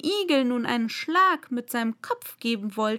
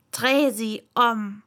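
slow, annoyed command; 4 dB louder, slower than usual. A narrator's reading. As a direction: order it slowly, with irritation. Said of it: "Die Königin wandte sich ärgerlich von ihm ab".

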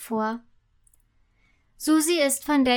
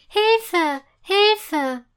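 higher, louder cry for help; calling for help; 6 semitones higher, 5 dB louder than usual. A narrator's reading. Sugar fairy to an unseen singer, calling for help. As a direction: call out to whoever might hear. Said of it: "ruft sie".

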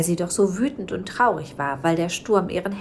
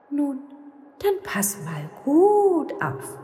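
much higher, slow ghostly voice; mysterious ghost; 10 semitones higher, slower than usual. Acted. Ghost telling the witch to watch closely, high and slow. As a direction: tease like a spirit about to do magic. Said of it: "sagte Silberhaar".

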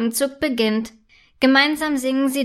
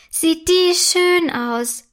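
delighted; 6 semitones higher, much slower than usual. A narrator's reading. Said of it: "freut sich Mera".

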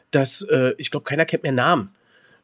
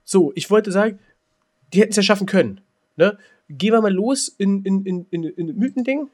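raised agitated voice; 6 semitones higher, 4 dB louder than usual. Real, not acted.

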